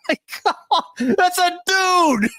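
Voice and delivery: laughing disbelief. Real, not acted.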